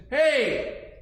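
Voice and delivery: lightly voiced high hail, then drawn out; hailing man. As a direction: call out to recruit the giant. Man in uniform hails giant.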